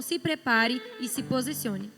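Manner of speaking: normal talking voice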